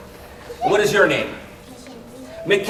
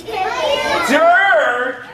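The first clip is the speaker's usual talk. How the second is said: mock disgusted dirt; 8 semitones higher than usual.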